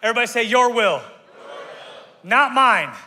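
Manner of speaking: rally the room, pushing harder; fired-up call and response. Shown as a demonstration. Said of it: "That wasn't real convincing".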